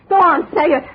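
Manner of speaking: angry taunt. Acted. Andrea at gunpoint shouts at Jake to dare him. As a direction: dare him at full volume.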